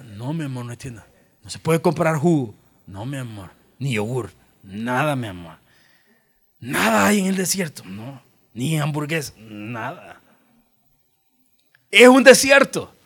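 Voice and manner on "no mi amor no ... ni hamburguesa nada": much softer and lower, gentle answers; tender father. Retold or imitated. Father gently telling his daughters there are no shops, soft tender voice. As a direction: soothe a small child with patience.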